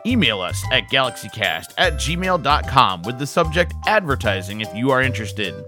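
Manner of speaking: upbeat, bright radio ad read; commercial read. Acted. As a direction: sell it like a radio spot. Reads ad for Star Productions.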